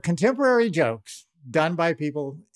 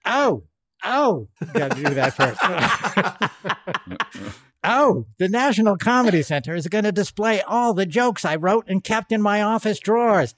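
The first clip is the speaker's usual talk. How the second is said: higher, brassy Joan Rivers squawk; Joan Rivers impression; 4 semitones higher than usual. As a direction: play a raspy old comedienne. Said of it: "Joan Rivers".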